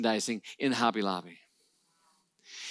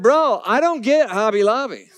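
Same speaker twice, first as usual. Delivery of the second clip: laughing; 7 semitones higher, 6 dB louder than usual. Real, not acted. Joking confession to David.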